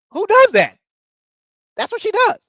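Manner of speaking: incredulous. Real, not acted. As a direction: shout the question in total disbelief. Repeats question, incredulous.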